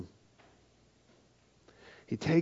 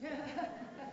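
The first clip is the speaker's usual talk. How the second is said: high breathy laugh; 15 dB quieter than usual. Real, not acted. Laughs at his own joke.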